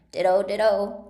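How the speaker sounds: loud, emphatic cry; shouted. A narrator's reading. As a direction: shout both words out, full volume. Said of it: "He shouted this so loud".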